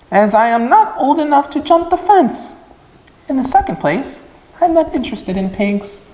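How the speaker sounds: put-on high, prim young lamb voice; a stuck-up lamb looking down on pigs; haughty lamb. Lamb telling Wilbur pigs mean nothing, high and snooty.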